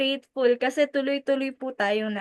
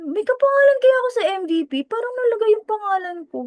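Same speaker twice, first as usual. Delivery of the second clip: fast, much higher, louder rush of disbelief; excited disbelief; 9 semitones higher, 3 dB louder, faster than usual. Retold or imitated. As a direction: blurt it out, unable to believe it. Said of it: "Sabi ko". (mabilis at mas mataas na hindi makapaniwala)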